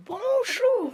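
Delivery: a high put-on polite greeting; high chirpy greeting. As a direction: sing out a falsely cheerful hello. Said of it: "Je fais".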